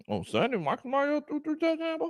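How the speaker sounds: incredulous. Real, not acted. Disbelief at a fan's claim.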